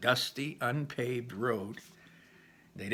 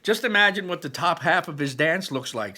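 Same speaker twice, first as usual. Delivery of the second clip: higher and louder teasing brother; Barley's voice; 6 semitones higher, 8 dB louder than usual. A narrator's reading. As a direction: tease about the dance. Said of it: "that was Barley's voice".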